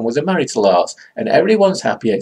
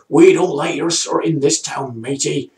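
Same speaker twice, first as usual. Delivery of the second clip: higher-pitched, badly faked American cowboy accent; poor American accent; 4 semitones higher than usual. Cowboy confronting Kwai Chang Caine in the saloon, badly faked American accent. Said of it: "That's not really an American accent".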